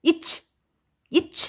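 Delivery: "இச்" said short, ending on a released ch; letter sound demo. Shown as a demonstration. Teacher showing children how to pronounce the letter, short letter sound demo. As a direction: model "இச்" as an isolated consonant sound. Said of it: "எப்படி உச்சரிக்கணும்?".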